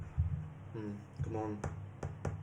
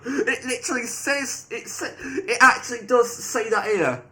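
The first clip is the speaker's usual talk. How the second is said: slow, high, louder disbelief; incredulous; 7 semitones higher, 6 dB louder, slower than usual. Real, not acted. Player finding the AI really wrote that, incredulous emphasis.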